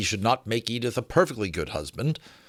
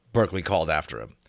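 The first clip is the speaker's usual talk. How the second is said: ordinary delivery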